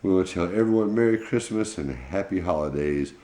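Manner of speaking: plain reading voice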